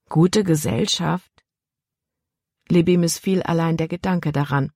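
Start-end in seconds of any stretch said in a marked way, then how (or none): 0.1-1.4 Gute Gesellschaft repeated slowly as a doubting question; girl scoffing at her father's words; incredulous echo. A narrator's reading. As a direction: echo gute with slow disbelief. Daughter echoes father in disbelief.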